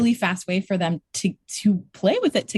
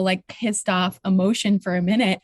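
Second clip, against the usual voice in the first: ordinary talk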